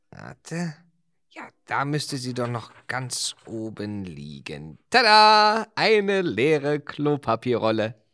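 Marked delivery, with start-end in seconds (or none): 4.9-8.0 loud, high triumphant reveal; triumphant tada. Real, not acted. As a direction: announce the find like a magician. Triumphantly finds the toilet roll.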